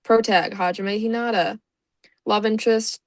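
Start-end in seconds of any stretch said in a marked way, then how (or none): none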